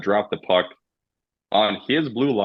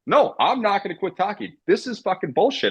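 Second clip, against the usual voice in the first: mock player defiance; 12 semitones higher than usual. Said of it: "He goes".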